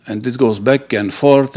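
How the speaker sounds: ordinary delivery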